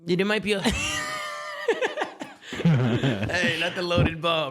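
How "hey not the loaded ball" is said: laughing. Real, not acted. Laughing at the loaded ball joke.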